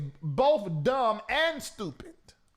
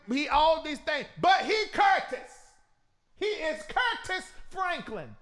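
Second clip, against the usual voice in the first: emphatic; 6 semitones higher than usual. Real, not acted. Defending Curtis Franklin.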